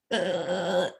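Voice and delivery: dümmlich verzogene Hundestimme; silly dumb pet voice. Retold or imitated. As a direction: spiel einen begriffsstutzigen Hund. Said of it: "Dann sagt der Hund sich". (alberne, dümmliche Haustierstimme)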